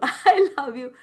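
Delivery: I love you through a laugh. Retold or imitated. Host recalls being moved, laughing through the words. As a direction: laugh as the feeling rises. Host retells receiving the affirmation.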